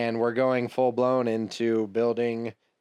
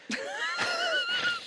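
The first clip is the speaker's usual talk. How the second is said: high laughing shriek; 5 dB quieter than usual. Real, not acted. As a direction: burst out in a squealing laugh. Laughs at friend's wedding.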